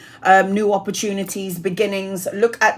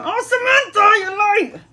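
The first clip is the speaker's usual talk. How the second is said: playful high call; 12 semitones higher than usual. Real, not acted. Teasing greeting to a viewer.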